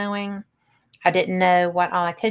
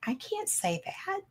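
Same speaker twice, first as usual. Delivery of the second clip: hushed protest; 12 semitones higher, 13 dB quieter than usual. Retold or imitated. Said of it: "I was just like".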